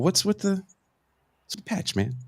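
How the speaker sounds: hesitant probing. Retold or imitated. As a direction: broach it delicately, voice creeping up.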